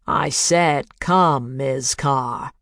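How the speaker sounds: slow, insistent demand over speakers; firm demand. A narrator's reading. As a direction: lay down the order slowly. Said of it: "punctuated the demand".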